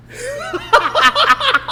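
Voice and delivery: real laugh shooting way up high; sudden high cackle; high laugh burst. Real, not acted. Laughs at Portnoy comparison.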